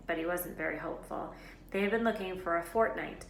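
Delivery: plain reading voice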